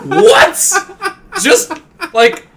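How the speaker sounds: high, loud exasperated plea; exasperated urging. Retold or imitated. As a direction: push her, you can't believe she refuses.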